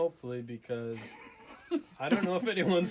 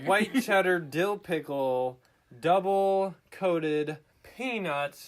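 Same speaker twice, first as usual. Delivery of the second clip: very slow, drawn-out product read; slow announcer read; 2 semitones higher, 4 dB louder, slower than usual. Acted. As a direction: savor every word slowly.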